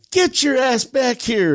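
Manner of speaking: high, drawn-out retold command; mock-furious holler; angry order. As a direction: call a runaway back, high and slow. Angry father orders runaway son back.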